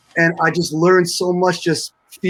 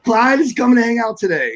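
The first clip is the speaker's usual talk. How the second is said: pitched up high, amazed at the news; stunned disbelief; 8 semitones higher than usual. Retold or imitated. Young drummer hearing his hero is coming, high amazed echo. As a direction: echo it back like you can't believe it. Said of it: "And I'm like".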